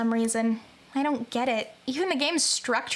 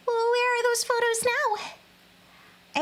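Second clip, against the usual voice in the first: put-on high girl voice; 10 semitones higher, much slower than usual. Voicing a scared schoolgirl character.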